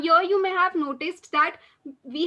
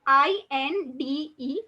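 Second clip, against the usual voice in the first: slow, careful letter-by-letter spelling; spelled out slowly; much slower than usual. Shown as a demonstration. Teacher spells the word slowly for students. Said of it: "Repeat after me".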